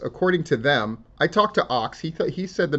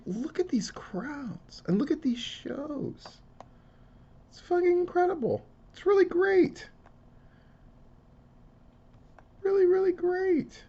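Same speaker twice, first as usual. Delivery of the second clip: squeaky high voice; 4 dB quieter than usual. Pretend. Gushing over wrestling crowds in squeaky falsetto. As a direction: gush in a tiny falsetto cartoon voice.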